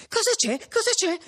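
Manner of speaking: high, urgent repeated question; alarmed questioning. A narrator's reading. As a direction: ask in alarm, voice pitched right up. Alarmed question, holding Perpetua back.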